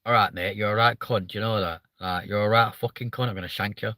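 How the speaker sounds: casual northern threat that sounds matey; northern English accent. Retold or imitated. Streamer imitating northerners threatening nicely, northern English accent. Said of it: "how come you northerners go".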